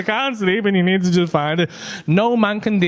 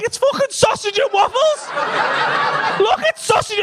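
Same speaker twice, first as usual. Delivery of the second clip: husband shouting at wife about the oven; exasperated shout. Retold or imitated. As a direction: yell it in comic frustration.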